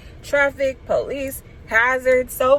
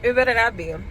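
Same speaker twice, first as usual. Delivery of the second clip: ordinary talk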